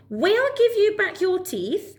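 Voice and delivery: high, slow frog; kindly bargaining frog. Acted. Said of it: "said Frog".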